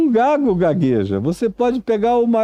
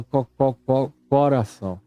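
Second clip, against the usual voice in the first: imitated stutter on the first syllable of coração; 4 semitones lower, 4 dB quieter than usual. Preacher showing how he used to stick on coração, stuttering. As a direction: get stuck repeating "co" before "coração" comes out. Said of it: "Eu sempre gaguejava quando eu falava coração".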